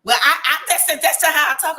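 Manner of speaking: a mother defending her loud voice; imitating loud mom. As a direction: play the mother insisting that's just how she talks. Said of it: "she's just like".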